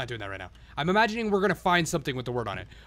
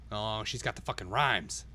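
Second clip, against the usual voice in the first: ordinary talk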